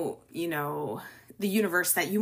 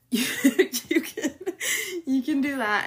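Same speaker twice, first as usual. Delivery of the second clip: laughing; 8 semitones higher than usual. Real, not acted. Giggling after her own pun.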